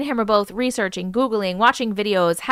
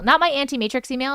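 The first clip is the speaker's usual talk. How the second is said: ordinary talk, nothing marked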